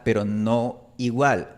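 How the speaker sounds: ordinary talk, nothing marked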